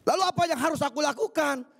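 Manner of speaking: high, whining plea to God; a small believer whining before a huge wall; pleading complainer. Acted. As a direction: beg God that the wall is too big.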